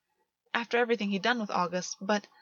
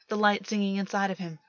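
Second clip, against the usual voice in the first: ordinary delivery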